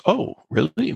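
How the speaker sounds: a hint of a surprised British gent; British director. British director hears the star wants a reshoot, lightly British surprise. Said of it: "who's British guy".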